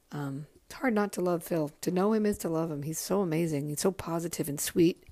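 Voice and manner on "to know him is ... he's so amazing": normal talking voice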